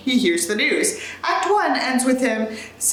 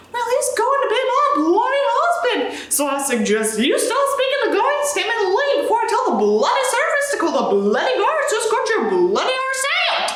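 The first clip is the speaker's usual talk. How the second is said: high, hammed-up British scolding; furious Josie; 10 semitones higher than usual. Reader voicing Josie threatening drunk Sanders, British. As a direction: spit out every bloody at him.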